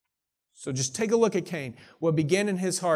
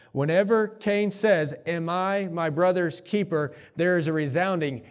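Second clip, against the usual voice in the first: fervent preaching; 2 semitones higher, 4 dB louder than usual. Acted. Pastor driving home Cain's question to the church, fervent. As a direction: preach it out, building.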